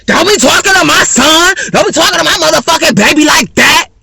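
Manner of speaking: high, loud mock outburst of an angry mother; shrill-free hammed-up mama defending her son; mocking angry mom. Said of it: "you turn".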